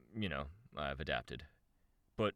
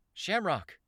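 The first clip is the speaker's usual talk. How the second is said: high, loud call to someone further off; 7 dB louder than usual. A narrator's reading. Narrator calls to Shamrock across rubble, calling out. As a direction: call out the name, pitched way up. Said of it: "I called out".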